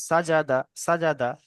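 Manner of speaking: "সাজাদা" as one compact word, vowels short; short vowels, no stretching. Shown as a demonstration. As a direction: say "সাজাদা" joined up, without drawing out any syllable. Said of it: "বিনা টানে তাড়াতাড়ি পড়তে হবে".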